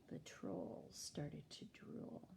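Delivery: soft, slow whispered narration. A narrator's reading. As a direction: whisper it slowly.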